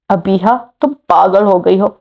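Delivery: ordinary delivery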